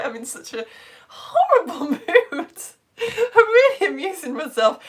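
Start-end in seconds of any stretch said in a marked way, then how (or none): 1.1-4.8 high, through laughter; laughing. Real, not acted. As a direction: crack up while saying it. Laughing at own mocking.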